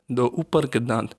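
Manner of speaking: usual speaking voice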